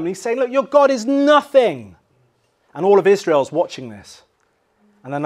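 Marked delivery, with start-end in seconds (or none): 0.4-2.0 high, loud scornful jeer; loud mocking put-down. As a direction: taunt them with contempt. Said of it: "he's mocking them".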